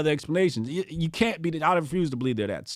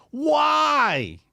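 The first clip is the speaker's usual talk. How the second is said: exasperated cry; 10 semitones higher than usual. Real, not acted. Exasperated rant.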